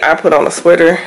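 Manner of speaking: normal talking voice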